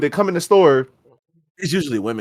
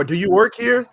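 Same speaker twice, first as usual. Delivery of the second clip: mock clueless customer; 6 semitones higher than usual. Mocking rude customer.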